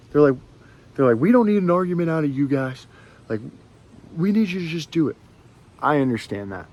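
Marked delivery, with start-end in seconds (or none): 1.2-5.2 mildly raised mock yell; retold yelling. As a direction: imitate them yelling, half-hearted. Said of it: "they start yelling at my friend".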